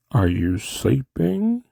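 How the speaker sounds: slow question swooping up high; old doctor. Acted. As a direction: draw it out like a kooky old man. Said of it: "This is the old guy in the film".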